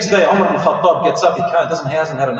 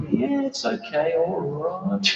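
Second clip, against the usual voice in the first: high, soft, drawn-out humouring; soft singsong placating, stretched right out; wary humouring; 3 semitones higher, 7 dB quieter, much slower than usual. Acted. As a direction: back away slowly while placating someone. Mock humouring while edging slowly away.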